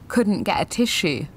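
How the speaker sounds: ordinary delivery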